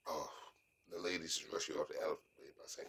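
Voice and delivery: ordinary talk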